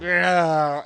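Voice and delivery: loud, high guttural retch; a mock gagging growl while throwing up; vomiting retch noise. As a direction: imitate a violent heave into the window. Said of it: "that made that noise".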